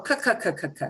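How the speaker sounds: a string of short "k" consonants; hard C sound. Shown as a demonstration. Teacher recapping C A, C O, C U, hard C sound. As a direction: repeat "k" like the C in cat. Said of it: "it'll have the hard K".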